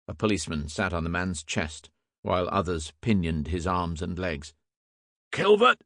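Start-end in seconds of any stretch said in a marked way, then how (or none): 5.3-5.8 high, loud cry of a name; calling out. A narrator's reading. Inspector summons his constable in the crowd, calling out. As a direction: shout urgently for your constable. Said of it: "cried Field".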